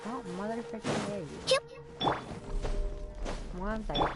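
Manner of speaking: ordinary talk, nothing marked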